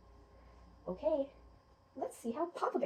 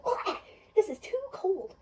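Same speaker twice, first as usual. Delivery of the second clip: high, drawn-out disgusted bleh; 8 semitones higher, 4 dB louder, slower than usual. Acted. Goldilocks tasting porridge that is too cold, disgusted. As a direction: spit out a nasty mouthful with distaste.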